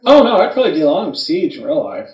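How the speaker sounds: normal talking voice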